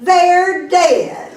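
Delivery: high-pitched mock despair; forlorn man. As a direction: answer as a hopeless man in a joke. Suicidal man answers the lady.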